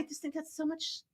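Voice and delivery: very soft, whispered admiration; hushed aside. Real, not acted. Admiring sister's word holiday, hushed aside. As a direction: drop right down to a near whisper.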